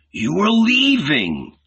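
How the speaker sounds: high, drawn-out outburst; sudden wild outcry, pitched well up; wild accusing cry. A narrator's reading. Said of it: "heard his wild, hoarse voice".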